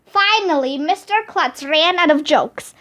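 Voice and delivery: plain reading voice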